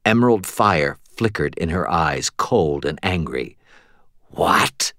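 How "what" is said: whispered, incredulous question; icy whisper. A narrator's reading. Angry queen challenges her knight's refusal, icy whisper. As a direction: whisper it like a cold warning.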